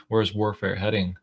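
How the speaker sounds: ordinary delivery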